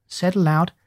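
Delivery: plain reading voice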